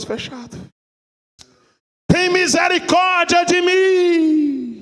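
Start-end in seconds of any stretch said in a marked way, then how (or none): 2.1-4.7 slow, tearful plea for mercy; anguished cry for mercy; pleading cry. Acted. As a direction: beg from torment, voice breaking. Rich man pleads from hell.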